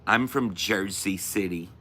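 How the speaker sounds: put-on Jersey accent; hammed-up New Jersey speech. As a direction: talk like a Jersey local. Imitating New Jersey locals' talk.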